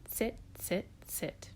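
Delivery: ordinary delivery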